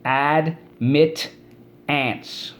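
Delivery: "Admit ants" split into AD, MIT, ANTS; syllable by syllable. Shown as a demonstration. Teacher leading class through admittance, syllable by syllable. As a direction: say "Admit ants" as three separate beats. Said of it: "Let's go".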